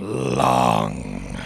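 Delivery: very low, loud, stretched-out word; sinister villain. Acted. Villain gloating over long wait, deep drawn-out menace. Villain ends slow reveal.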